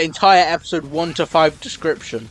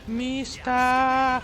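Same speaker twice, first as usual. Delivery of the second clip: high call; 11 semitones higher, 4 dB quieter than usual. Acted. Calling out high.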